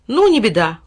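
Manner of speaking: breezy no-matter tone; carefree. A narrator's reading. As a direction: brush it off cheerfully. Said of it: "беспечно махнул рукой Тиль-Тиль".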